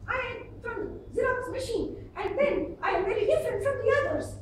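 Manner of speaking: imitating a high-pitched young lady; high mimicked self-introduction. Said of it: "Her pitch is high".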